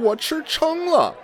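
very high whining complaint; whiny overstuffed. Acted. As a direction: moan about being stuffed. Acted stuffed-belly skit.

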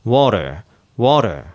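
"water" with the tongue casually flicked for T; American "water", T close to a Japanese R; lazy flapped T. Shown as a demonstration. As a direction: tap the T loosely in "water". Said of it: "いい加減に舌をこう弾いて".

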